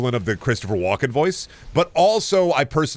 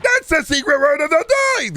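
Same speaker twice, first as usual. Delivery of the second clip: put-on much higher and louder, squeaky manic man-child; Pee Wee Herman voice; 5 dB louder than usual. Streamer showing his Pee Wee Herman impression, high and loud. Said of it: "a little bit of a Pee Wee Herman voice".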